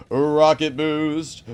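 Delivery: slow, loud, drawn-out call; hyped announcement. Acted. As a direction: announce it like a launch. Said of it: "three, two, one".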